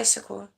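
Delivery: [name] beginning with plain 's'; [name] without the H. Shown as a demonstration. Showing how a lot of them say it, [name] as 'Sakur'. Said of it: "a lot of them say [name]".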